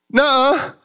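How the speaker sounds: high squeaky denial; disbelieving dad. Retold or imitated. Father refusing to believe the gift, high and squeaky. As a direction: squeal a childlike no way.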